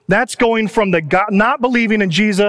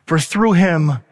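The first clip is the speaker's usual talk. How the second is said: preaching. Real, not acted. Preaching the gospel.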